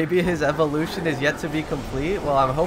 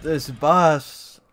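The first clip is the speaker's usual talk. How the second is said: drawn-out complaint; 4 semitones higher, much slower than usual. Real, not acted. Fed up with the boss.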